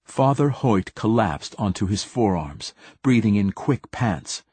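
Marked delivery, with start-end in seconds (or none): none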